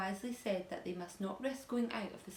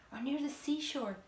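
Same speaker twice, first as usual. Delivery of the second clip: eagerly; 6 semitones higher than usual. A narrator's reading. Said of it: "said James eagerly".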